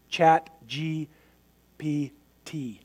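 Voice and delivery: spelled out slowly, letter by letter; Chat, G, P, T with careful pauses between. Real, not acted. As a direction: take the name slowly so as not to trip. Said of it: "I have to say that slowly".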